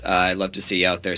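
normal talking voice

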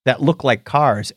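usual speaking voice

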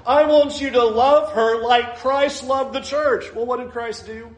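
impassioned sermon voice; fervent preaching. Real, not acted. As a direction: press it home to the husbands. Preaching at full force.

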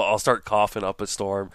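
ordinary talk, nothing marked

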